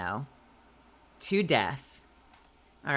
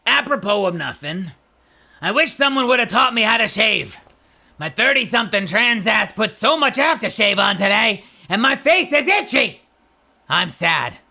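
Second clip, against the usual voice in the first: loud, high, gruff goblin voice; 6 semitones higher, 8 dB louder than usual. Acted. Redoing the meme line as a gruff goblin. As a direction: bark it out like a cranky little goblin. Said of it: "growly gremlin".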